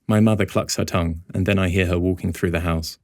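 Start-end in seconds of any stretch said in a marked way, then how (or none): none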